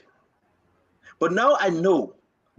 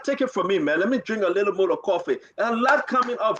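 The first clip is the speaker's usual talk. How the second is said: normal talking voice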